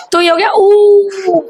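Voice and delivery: slow, stretched ऊ for the class; long drawn-out ऊ. Shown as a demonstration. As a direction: draw the long vowel out slowly. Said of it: "जोर से लंबी सी आवाज".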